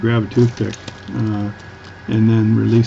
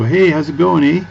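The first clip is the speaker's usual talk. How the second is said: bright, higher Ontario "eh"; Southern Ontario accent; 6 semitones higher than usual. Retold or imitated. Vlogger imitating a Southern Ontario greeting, higher Ontario accent. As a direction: toss off a friendly Ontario greeting. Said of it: "maybe Ontario, Southern Ontario, they go".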